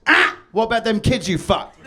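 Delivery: loud shouted heckle, pitch jumping up; buzzer heckle. Pretend. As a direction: slam the buzzer and yell the accusation.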